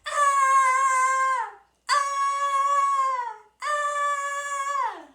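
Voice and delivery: three loud high screams; repeated screams. Retold or imitated. Brother screaming while they search the maze, repeated shrieks. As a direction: scream over and over in fright. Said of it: "my brother going".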